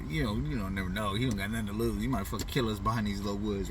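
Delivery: usual speaking voice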